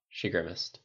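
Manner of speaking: ordinary delivery, nothing marked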